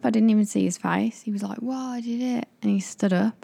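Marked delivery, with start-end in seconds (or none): 1.6-2.5 funny voice. Retold or imitated. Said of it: "I always do that voice when I'm doing impressions".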